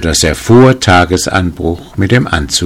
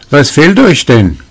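raised, louder voice hailing a passer-by; calling out; 9 semitones higher, 6 dB louder than usual. A narrator's reading. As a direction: call the question over, high and loud. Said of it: "rief er ihm zu".

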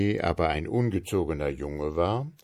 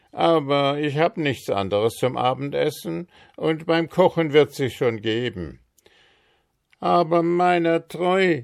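high, louder bogeyman voice; a storybook child-eater, fussing then calling out; Mister Marker calling his wife; 9 semitones higher, 4 dB louder than usual. A narrator's reading. Mister Marker calls out to his wife Sally, high and loud.

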